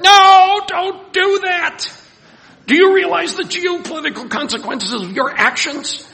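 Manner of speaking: shrieking way up high and loud, aghast; frantic scolding. Retold or imitated. Preacher yelling at Abraham and Sarah in Genesis, frantic and high. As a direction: yell a warning at someone making a huge mistake. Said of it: "I talk to my Bible".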